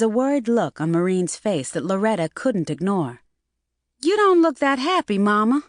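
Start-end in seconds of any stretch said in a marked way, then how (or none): 4.0-5.6 high, teasing daughter voice; girl voice. Acted. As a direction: tease her mother in a high voice. Loretta voiced.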